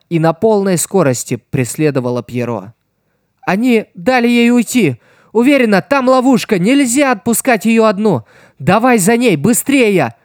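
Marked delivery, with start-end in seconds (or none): 3.4-10.1 loud, high, urgent rush of words; rattled off. A narrator's reading. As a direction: blurt it all out in a hurry. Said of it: "Скороговоркой выпалила Стелла".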